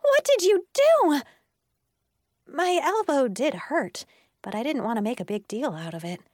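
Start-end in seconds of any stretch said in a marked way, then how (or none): 0.0-1.2 high, loud outraged shout; shrieked, horrified demand; accusing yell. A narrator's reading. Harper keeps yelling at Georgette.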